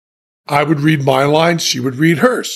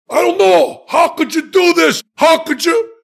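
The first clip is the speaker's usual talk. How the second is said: De Niro yelling; 12 semitones higher than usual. Retold or imitated. Imitating De Niro yelling at the man who sold him out. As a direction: yell it like a betrayed friend. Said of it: "He starts doing this".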